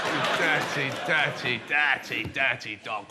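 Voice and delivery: very low, dropping further with each repeat; low lascivious scolding; teasing accusation. Pretend. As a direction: sink down into a naughty growl of mock blame. Repeated teasing of audience member.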